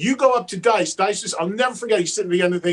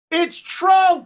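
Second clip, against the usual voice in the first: higher, raised call; a raised call to Dice; 9 semitones higher, 4 dB louder than usual. Retold or imitated. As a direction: call it out so he recognises you. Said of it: "And I go".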